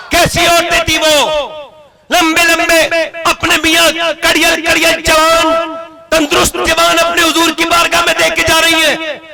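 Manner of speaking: ringing high sermon delivery, phrase endings stretched; full-force preaching. Real, not acted. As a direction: proclaim it to the crowd on a high sustained pitch.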